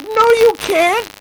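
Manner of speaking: very high, loud, slow refusal; exasperated protest. Retold or imitated. Retelling his reply to the boss, exasperated. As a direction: squeal the contradiction, drawing it out.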